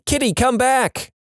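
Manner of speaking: high, drawn-out call after a runaway cat; understated yell, stretched and high; mild narrated yell. A narrator's reading. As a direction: call it out slowly, pitched high, without real volume. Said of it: "chasing the neighbor's cat while yelling".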